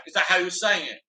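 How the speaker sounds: ordinary talk, nothing marked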